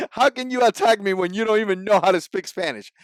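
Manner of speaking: usual speaking voice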